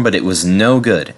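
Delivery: ordinary delivery, nothing marked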